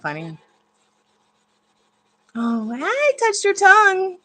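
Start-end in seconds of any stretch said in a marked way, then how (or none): 2.3-4.2 high, slow, loud squeal of surprise; startled squeal. Real, not acted. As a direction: shriek at the touch. Squealing at the pet.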